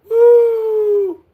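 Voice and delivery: high loud celebratory whoop. Real, not acted. Streamer cheering a donor, high loud whoop. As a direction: let out a big cheering woo.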